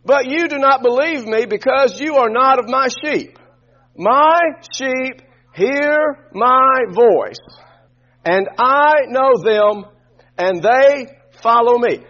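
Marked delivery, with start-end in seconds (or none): 4.0-12.0 each word spaced and weighted, slow; slow, deliberate scripture recitation; slow emphatic recitation. Shown as a demonstration. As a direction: lay the verse down word by word for the church to repeat. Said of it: "Can we say this together".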